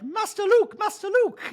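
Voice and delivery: high fussy droid voice; C-3PO voice. Retold or imitated. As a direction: fret like a protocol droid. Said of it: "in Star Wars, it'd be like".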